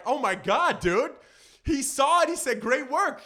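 loud, high giddy gushing; can't contain the thrill; starstruck gushing. Retold or imitated. Reliving Obama's compliment, loud giddy gushing. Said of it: "I'm just like".

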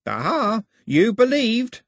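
high-pitched gleeful triumph; crowing. A narrator's reading. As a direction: crow it like you caught him out. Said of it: "he crowed".

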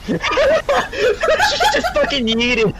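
laughing through the words; can barely talk for laughing. Real, not acted. Laughing at bad movie scene.

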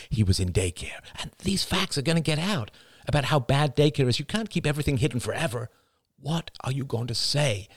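insistent, rising pitch on the protests; pleading argument. Acted. Husband arguing with his wife over daycare, insistent pleading. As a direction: press the point with hushed urgency.

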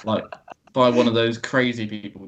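usual speaking voice

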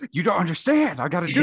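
mock frantic. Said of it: "joins the acted student".

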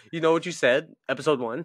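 high, loud playful accusation; mock confrontation. Partner confronting with the podcast recording, mock accusing. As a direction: confront them as if catching them out, hammed-up.

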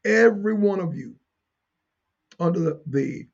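ordinary delivery

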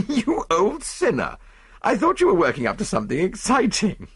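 high-pitched, amused mock reproach; laughing. A narrator's reading. Friend amused by the detective's anticlimax, laughing high voice. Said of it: "I laughed".

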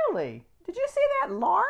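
high, squeaky put-on friend voice, mocking. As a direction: play the jealous friend in a high mock voice. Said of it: "You're so funny with the lark".

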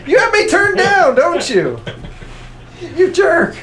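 high laughing protest; laughing complaint. Real, not acted. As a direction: protest while cracking up. Complaining about being turned down.

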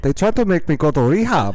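put-on high, loud accented vampire singer; hammed-up Transylvanian take on a pop lyric. Co-host playing vampire playing singer, high hammed-up accent. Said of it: "playing Nadja playing [name]".

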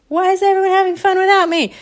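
put-on high, quick indignant kid; left-out sister. Sister hears laughter from upstairs, high indignant complaint. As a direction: pitch it way up in childish protest. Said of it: "Candy hears the laughter from upstairs".

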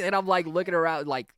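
ordinary talk

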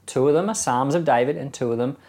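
normal talking voice

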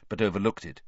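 usual speaking voice

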